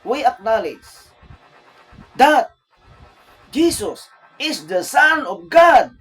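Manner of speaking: loud stage emcee announcing the guest of honor; booming MC announcement. Shown as a demonstration. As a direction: announce him to the crowd like an emcee. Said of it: "acting MC announcing Jesus".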